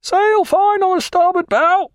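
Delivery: high, loud call from the masthead; lookout hailing. Acted. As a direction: call down from aloft, unhurried. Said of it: "hailed the lookout".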